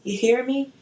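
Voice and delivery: hyped-up. Real, not acted. Hype call to audience.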